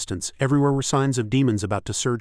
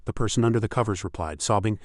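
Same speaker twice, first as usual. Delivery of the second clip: ordinary delivery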